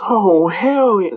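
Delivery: high, drawn-out flippant retort; flippant judge. Retold or imitated. Judge answering the prosecutor's question, flippant. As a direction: stretch it out, high and cheeky. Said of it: "kinda flippant".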